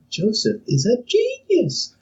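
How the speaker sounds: high, slow, reverent praise; awed fans. Acted. Everyone praising Joseph as an artist, awed. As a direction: marvel at his genius. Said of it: "And everyone's like".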